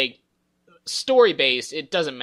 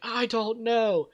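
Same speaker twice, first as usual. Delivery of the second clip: Ben; 9 semitones higher, much slower than usual. Retold or imitated. Retelling Ben yelling at Kenny, hammed-up but contained. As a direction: stretch it out as an imitated anguished cry. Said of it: "this whole yelling".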